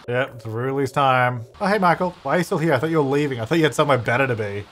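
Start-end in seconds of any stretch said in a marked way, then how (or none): none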